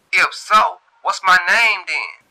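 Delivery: high, loud, slow challenge; Shantae challenging. A narrator's reading. Shantae challenging the caller to say her name, slow and high. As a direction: test him slowly, up high. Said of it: "Shantae asks".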